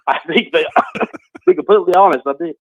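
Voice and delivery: laughing while answering; giggling through the words. Real, not acted. Laughing at the joke question.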